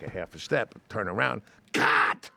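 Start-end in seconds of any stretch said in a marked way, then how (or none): 1.7-2.3 loud clipped cut; loud mock director's cut; yelled cut. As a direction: shout it like the director stopping the shot. Retold director yelling cut.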